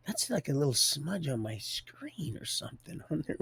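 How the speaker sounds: hushed, voiceless musing; whisper. Retold or imitated. Puzzled whisper while peering at a smudge on the screen.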